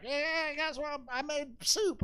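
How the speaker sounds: high put-on granny voice; squeaky sweet grandma offering soup; old grandma voice. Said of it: "She's like".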